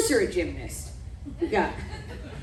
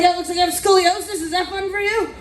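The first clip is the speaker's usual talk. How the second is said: incredulous shout; 8 semitones higher than usual. Retold or imitated. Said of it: "You're like".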